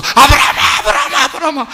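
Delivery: loud, high, panicked calling of the name; frantic calling. Acted. God frantically calling Abraham to stop him. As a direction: cry out to stop the knife. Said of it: "하나님이 막 떨어서".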